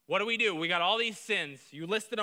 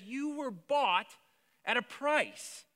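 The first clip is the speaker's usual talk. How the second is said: slow, higher, deliberate quote; slow emphasis; 4 semitones higher, much slower than usual. Acted. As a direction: drag each word out for weight. Quoting Paul, slow emphatic.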